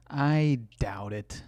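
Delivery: slow, laughing I doubt it. Real, not acted. Streamer laughing at a vegan claim, slow laughing doubt. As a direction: chuckle it out in disbelief.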